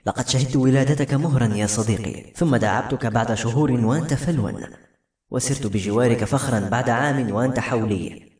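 plain reading voice

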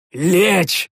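sharp order to drop; shouted command. Acted. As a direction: bark it out to the men. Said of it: "выкрикнул я".

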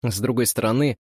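plain reading voice

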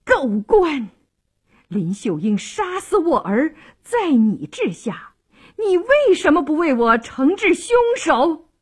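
high, indignant noblewoman's tirade; acted furious scolding; cursing. A narrator's reading. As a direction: berate the official, pitch climbing to the end. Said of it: "骂道".

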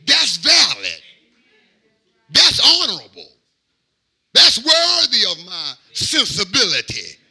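loud, rising sermon delivery that climbs toward a cry; full-force preaching. Acted. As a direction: preach it to the whole church, building.

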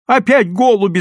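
loud, high indignant yell; furious cry at trespassers; angry shout. A narrator's reading. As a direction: shout as an outraged neighbour. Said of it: "закричала она".

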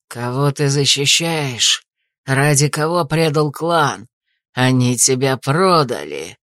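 low, slow, menacing assassin voice. A narrator's reading. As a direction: growl it out slowly, full of contempt. Assassin confronts Cain.

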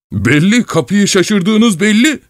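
high, drawn-out scoff; mock the excuse indignantly; angry sarcasm. A narrator's reading. Old man scoffing at the intruder's excuse, angry sarcasm.

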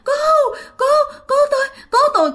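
very high, choked, sobbing cries; broken cry for help. Retold or imitated. Dreamer unable to finish the cry, high choked sobbing shout. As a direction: gasp out broken pleas. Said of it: "Không thể nào la được mà cứ".

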